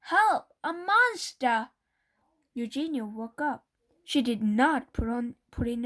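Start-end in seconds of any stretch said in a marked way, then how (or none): 0.0-1.7 high, loud cries for help; panicked yelling; frightened screaming. A narrator's reading. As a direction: scream for your sister in terror. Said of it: "shouted Baby".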